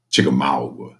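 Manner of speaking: Chickamauga drawled low and Southern; southern accent. Shown as a demonstration. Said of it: "you have to say with a southern accent".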